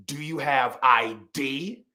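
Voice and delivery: slow, deliberate, mildly harsh challenge; harsh tones. Retold or imitated. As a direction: imitate a scowling cashier's stern demand. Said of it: "the harshest of tones".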